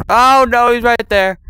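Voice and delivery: high, loud frightened cry; real panic as he appears; panicked shout. Real, not acted. Slender Man suddenly appears.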